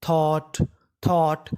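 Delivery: "थॉट" said twice, low and clear; teacher showing "थॉट"; model pronunciation. Shown as a demonstration. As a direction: say "थॉट" carefully for students. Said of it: "तो meaning बनी".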